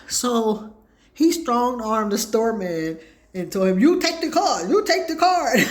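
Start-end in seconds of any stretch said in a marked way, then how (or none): 3.8-5.6 high, loud, laughing insistence; retold insistent shout. Man forcing the store man to take his card, laughing shout. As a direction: laugh while shouting the demand.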